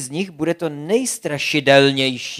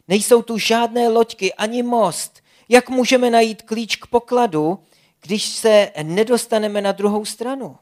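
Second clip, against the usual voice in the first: higher, louder anxious plea; a fretful little girl, raised and urgent; worried Zlatka; 7 semitones higher, 6 dB louder than usual. A narrator's reading. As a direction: fret over the river, pitched up. Zlatka worried about crossing river.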